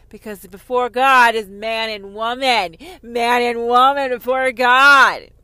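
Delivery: loud, slow sermon cadence; preaching shout. Acted. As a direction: hammer it like a fire-and-brimstone preacher. Preaching climax.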